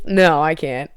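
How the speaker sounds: ordinary delivery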